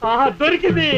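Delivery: high, elated exclamation; sudden high-pitched eureka; triumphant discovery. Acted. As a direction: cry out as the idea strikes.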